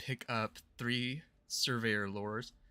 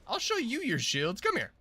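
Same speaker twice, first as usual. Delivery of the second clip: higher and louder taunt; excited taunt; 10 semitones higher, 4 dB louder than usual. Real, not acted. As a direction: call them over, pumped up. Taunting enemies in game.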